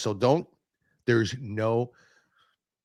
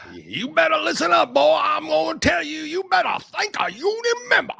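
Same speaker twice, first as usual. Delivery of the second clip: mock Southern accent. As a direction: belt it out like a hammed-up Southern preacher. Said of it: "So, like".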